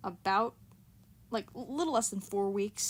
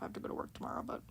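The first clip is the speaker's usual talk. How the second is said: whisper; 6 dB quieter, much faster than usual. Real, not acted. Whispered complaint about work.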